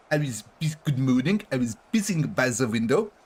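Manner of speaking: lightly hammed-up French accent from an old sitcom; mock French policeman. Streamer quoting a sitcom policeman, hammy French accent. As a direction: say it like a Brit faking French. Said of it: "British man pretending to be a French policeman".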